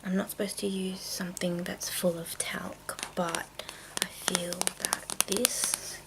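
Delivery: ordinary talk, nothing marked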